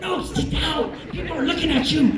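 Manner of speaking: mildly frantic, hurried order to sit; acted-out exasperation; flustered pleading. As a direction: plead with an embarrassing subordinate. Embarrassed supervisor begs airman to stop.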